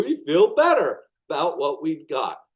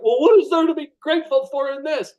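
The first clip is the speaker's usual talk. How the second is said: mock indignant question; 9 semitones higher, 4 dB louder, faster than usual. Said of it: "you're like".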